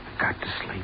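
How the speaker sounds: tired whisper to himself; hushed, drowsy whisper. Acted. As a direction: breathe it out, begging for sleep. Sleepless, muttering alone at night.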